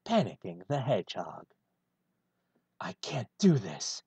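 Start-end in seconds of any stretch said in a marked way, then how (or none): none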